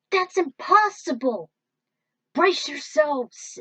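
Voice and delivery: high, loud and slow, stunned; shocked shout. Acted. Hero shouting to his crew as the plasma hits, shocked. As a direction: cry out in disbelief, then warn the crew.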